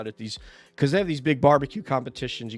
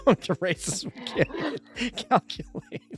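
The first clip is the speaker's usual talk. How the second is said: high, laughing through the words; 7 semitones higher than usual. Real, not acted. Host teasing a racer about huge RV mileage, laughing. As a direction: let laughter stretch and lift the words.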